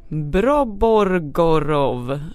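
Broborgorov drawn out very slowly; careful pronunciation. Real, not acted. Host attempting a listener's signature, Broborgorov slowly and carefully pronounced. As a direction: sound out Broborgorov with great care, syllable by syllable. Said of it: "nu ska jag uttala det här".

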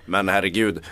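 usual speaking voice

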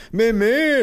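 very high, muffled denial; giggly no through a swollen mouth; laughing mother. A narrator's reading. Mother denying stings to officer, high muffled amusement. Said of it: "lachte meine Mutter".

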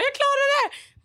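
very high, excited retold squeal; triumphant cry. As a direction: reenact a proud victory shout. Retells triumphant call to mother.

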